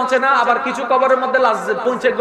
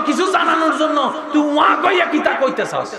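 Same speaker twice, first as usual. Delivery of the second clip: ordinary talk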